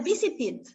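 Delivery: "visited" with the -ed said as an extra 'id' syllable; final -id. Shown as a demonstration. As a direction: sound out the 'id' ending of "visited". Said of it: "la pronunciación va a ser id". ("visited" con la terminación pronunciada 'id')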